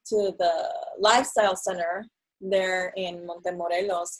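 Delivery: ordinary talk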